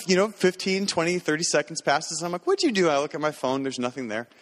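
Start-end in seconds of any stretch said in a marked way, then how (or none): 2.5-2.9 mock alarm. Kid took his phone.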